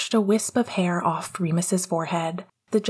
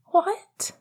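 a mild croak, jumping much higher; a stunned little croak; 12 semitones higher than usual. A narrator's reading. Ian shocked by Sirius's remark, lightly croaked. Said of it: "he croaked out".

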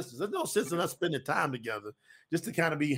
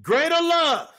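ringing scripture quote that settles as it ends; proclaiming scripture; 5 dB louder than usual. Acted. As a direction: proclaim the verse, pausing between phrases.

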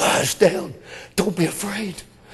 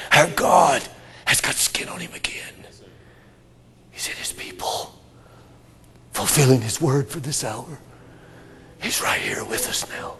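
soft, lower whisper; whispered awe; hushed whisper; 4 semitones lower, 5 dB quieter than usual. Acted. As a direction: reveal it softly, close. Hushed proclamation.

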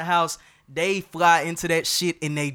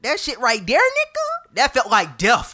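high rising exclamation; excited exclaim; 9 semitones higher than usual. Real, not acted. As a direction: yelp it out, pitch up. Exclaiming about hard week.

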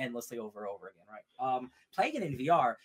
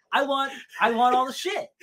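loud, high comic confession; greedy outburst; 10 semitones higher, 7 dB louder than usual. Real, not acted. Gamer admitting to buying every Skyrim copy, greedy outburst. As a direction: raise it into an exuberant demand.

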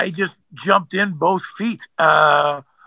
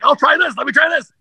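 higher, eager quoted voice; eager try-out; 11 semitones higher, 4 dB louder than usual. Retold or imitated. Actor to casting agent, keen to try more voices, eager and higher. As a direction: act keen to try another take. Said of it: "you're like".

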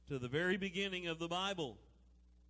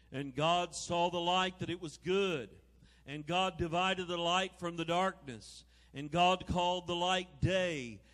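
booming biblical narration; 5 dB louder than usual. Acted. Reads Genesis aloud from pulpit.

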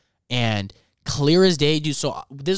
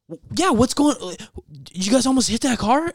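high, stammering alarmed question; panicked questioning; 10 semitones higher than usual. Retold or imitated. Grandson asks grandparents about a near crash, panicked. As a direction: re-enact a rattled, high-pitched demand.

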